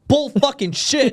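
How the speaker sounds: high, slow swearing burst; mock angry swearing. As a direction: spit it out like a family argument. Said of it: "just hear".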